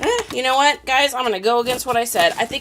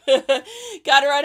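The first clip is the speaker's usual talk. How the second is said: laughing; 5 semitones higher, 6 dB louder, slower than usual. Real, not acted.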